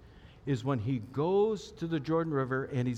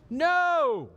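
much higher, louder, one flat-out refusal; insistent no; 14 semitones higher, 8 dB louder than usual. Retold or imitated. Peter shouting back at Jesus, high and insistent. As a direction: shout the no in protest. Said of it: "Peter insisted".

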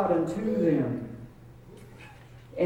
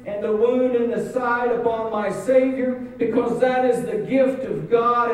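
raised, insistent sermon delivery; impassioned preaching; 4 semitones higher, 4 dB louder than usual. Acted. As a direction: build the story with mounting urgency. Preaching at rising force.

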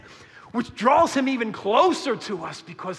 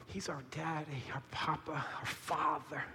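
hushed reverence; 4 semitones lower, 15 dB quieter than usual. Acted. Sermon hushed climax.

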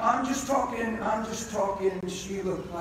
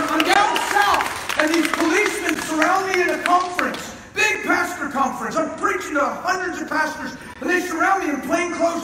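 loud, high preaching shout; full-force preaching; 9 semitones higher, 11 dB louder than usual. Real, not acted. Preacher shouting to the congregation that he'll never die, full force. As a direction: preach it out with rising fire.

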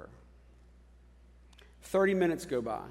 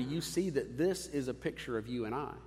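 ordinary talk

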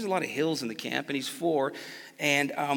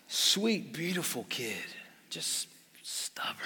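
soft, trailing to a whisper on stubborn; fading aside; 7 dB quieter, slower than usual. Real, not acted. Softly confiding about his son to the congregation. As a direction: let it drop to a breathy hush.

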